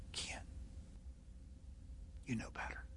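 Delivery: soft whispered nudge; quiet inner voice. Pretend. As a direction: hush it like a still small voice. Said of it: "But it may be".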